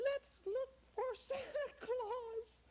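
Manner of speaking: put-on very high, worried little girl; tiny lost girl, close to tears. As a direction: squeak it like a lost child. Lara voice.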